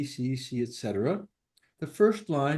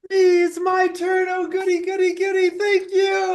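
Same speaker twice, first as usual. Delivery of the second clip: hammed-up high-pitched excitement; mock giddy glee; 8 dB louder than usual. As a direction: play an overjoyed kid finally getting a turn. Mock glee at getting turn.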